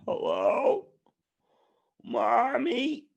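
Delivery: high little put-on voice; mock lost child. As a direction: call out like a child seeking mother. Playing lost child as connection drops.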